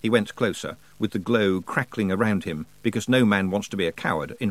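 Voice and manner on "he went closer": ordinary delivery, nothing marked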